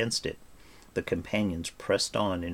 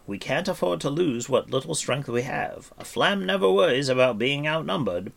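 higher, emphatic bard's voice; Fluter the bard, persuasive; 7 semitones higher, 3 dB louder than usual. Acted. Bard persuades Taran to stay with companions, persuasive. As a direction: talk the youngster round, voice raised.

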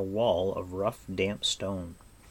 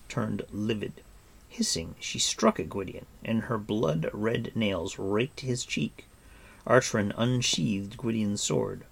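usual speaking voice

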